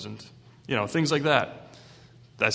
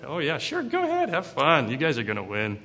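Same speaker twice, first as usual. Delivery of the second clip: breezy mock encouragement, pitched up then dropping; sarcastic; 4 semitones higher than usual. Prophet telling the kings to go to battle, sarcastic. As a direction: humour them with fake approval. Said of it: "he's just being really sarcastic".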